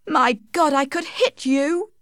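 high-pitched, furious threat; angry outburst. A narrator's reading. Tess threatens Alec after his mockery, angry. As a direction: let the anger burst out high. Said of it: "cried Tess angrily".

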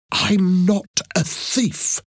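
slow, high, emphatic protest; insistent denial. A narrator's reading. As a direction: draw out each word to insist. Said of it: "she repeated".

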